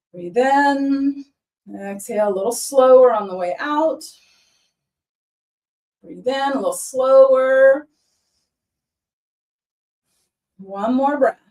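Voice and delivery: calm, even yoga instructor voice; guided yoga cues. Acted. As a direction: talk them through each stretch gently. Leads a chair yoga practice.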